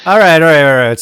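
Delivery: loud rallying call; rally everyone, loudly; loud call to order. Real, not acted. Calling the show to order.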